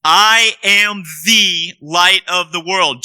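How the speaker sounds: slow, with weight on "I" and "the"; stress on I and the. Shown as a demonstration. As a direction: press on "I" and "the" deliberately. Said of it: "I want you to notice I and the".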